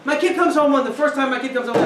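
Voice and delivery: usual speaking voice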